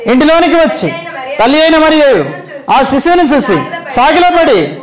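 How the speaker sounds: high, joyful rising recital of the verse; exalted scripture reading. Acted. Preacher reading the wise men's joy to the church, high and exalted.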